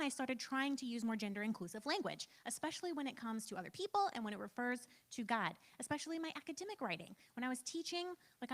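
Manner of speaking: ordinary talk, nothing marked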